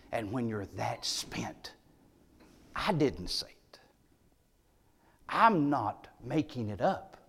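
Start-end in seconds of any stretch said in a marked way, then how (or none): 0.1-3.8 hushed whisper to the congregation. Real, not acted. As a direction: lean in and whisper it. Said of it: "voiceless hush".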